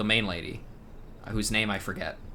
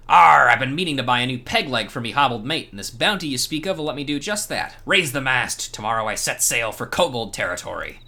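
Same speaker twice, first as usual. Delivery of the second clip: loud opening, pirate growl of a boast; hammed-up pirate; 2 semitones higher, 4 dB louder than usual. Streamer reads the pirate's quest to the hero, hammed-up. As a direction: play the swaggering old pirate. Voicing pirate NPC dialogue.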